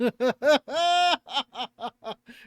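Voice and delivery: loud, high burst of laughter; big real laugh. Real, not acted. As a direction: crack up at your own story. Laughs at his own story.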